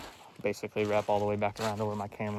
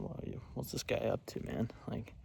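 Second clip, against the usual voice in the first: hushed whisper, imagining the deer's thoughts; a whisper voicing the wary deer; imagined deer whispering; 6 dB quieter than usual. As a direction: breathe it out so the deer won't hear. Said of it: "They're probably wondering".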